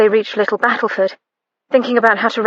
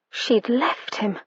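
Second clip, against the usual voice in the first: ordinary delivery, nothing marked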